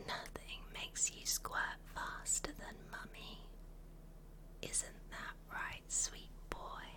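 very soft intimate whisper; mummy voice whisper. Acted. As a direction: whisper close in the ear. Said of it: "when I use my mummy voice".

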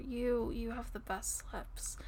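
slow intimate whisper. Real, not acted. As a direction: whisper it slowly and close. Intimate compliment.

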